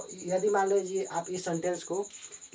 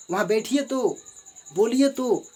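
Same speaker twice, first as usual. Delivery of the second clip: higher and louder urging; emphatic command; 6 semitones higher, 5 dB louder than usual. Shown as a demonstration. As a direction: push the request hard. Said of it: "जोर दे रहे हैं ना".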